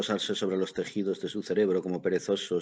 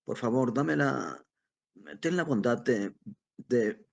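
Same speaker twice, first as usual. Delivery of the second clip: put-on higher, halting old man's plea; flustered, faltering politeness; groping for words; 5 semitones higher, slower than usual. A narrator's reading. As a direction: fumble the request, breaking off and restarting. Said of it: "tratando de hallar las palabras adecuadas".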